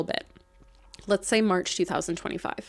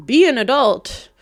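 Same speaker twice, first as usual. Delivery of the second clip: sharp scolding, much louder; 5 semitones higher, 11 dB louder than usual. Acted. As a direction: tell them off, loud and firm. Repeats scolding, louder second time.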